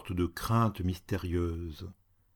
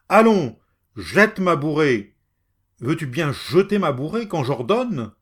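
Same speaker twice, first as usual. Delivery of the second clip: higher and louder, commanding; angry scolding; 4 semitones higher, 8 dB louder than usual. Acted. Furious landowner ordering the woman away. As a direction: order her to drop the wood. Cat berating beggar woman.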